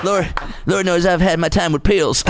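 put-on impression of a confiding ex-president; George Bush. Mocking Bush admitting to pills, folksy impression. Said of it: "He's like".

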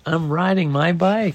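high-pitched proud announcement; proud shout. A narrator's reading. Daniel rides forward at last, high proud cry. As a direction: crow it with mild triumph. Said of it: "shouted Daniel proudly".

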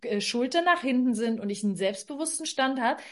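ordinary delivery

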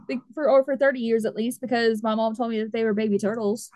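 usual speaking voice

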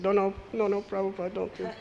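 put-on high, pleading child voice; little girl begging. Little girl begging Prabhupada not to throw her, high and pleading. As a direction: beg like a scared little girl.

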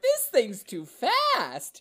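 mock alarm. Retelling first highway drive.

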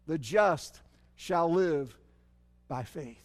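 linger, then let it fall away; slow solemn quote. Shown as a demonstration. Preacher quoting the verse a third time, slow and hushed. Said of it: "this verse is quoted three times".

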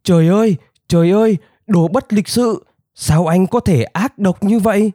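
high, outraged little-rabbit cry; indignant high-pitched protest; straining yell. A narrator's reading. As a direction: strain a tiny throat to yell. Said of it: "cố gồng cái cổ họng nhỏ bé của mình và gào lên".

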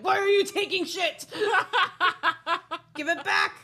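high, loud, laughing mock scolding; hammed-up yell at the Fae. Host yells at the Fae for stealing her things, mock angry.

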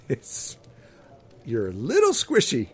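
ordinary talk, nothing marked

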